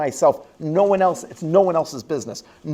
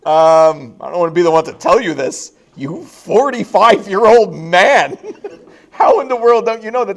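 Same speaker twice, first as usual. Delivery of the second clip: incredulous; 6 semitones higher, 10 dB louder than usual. Retold or imitated. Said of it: "I'm like".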